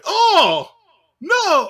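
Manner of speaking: high, loud mock outcry; acted exclamation. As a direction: cry it out like an overdone voice actor. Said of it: "get the sound right".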